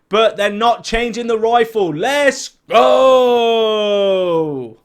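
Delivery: loud, high celebratory shout; hyped, drawn-out victory yell; excited cheer. Real, not acted. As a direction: cheer it out, stretching the last word long. Celebrating that rifle stays unchanged.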